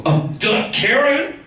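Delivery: high "obviously" retort; mocking kid. Nine-year-old answering his older brother, mockingly obvious. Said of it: "And Charlie goes".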